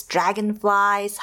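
usual speaking voice

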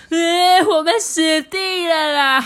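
high, loud, slow wail; hammed-up terrified bawling; crying frog. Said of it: "吓得大哭起来了".

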